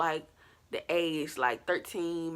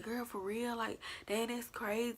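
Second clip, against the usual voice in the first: higher, much softer imitated amazement; friends' reaction; 5 semitones higher, 10 dB quieter than usual. Friends hear the story at school, softly imitated amazement. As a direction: lightly mimic friends reacting, hushed and pitched up.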